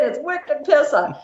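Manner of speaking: put-on high, slow Boston-accent drawl; mock Boston accent. Friends making fun of the runner's Boston accent, mocking. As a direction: tease with an exaggerated Boston accent. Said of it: "making fun of my accent".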